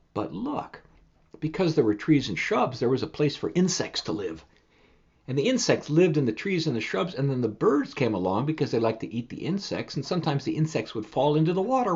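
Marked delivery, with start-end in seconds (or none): none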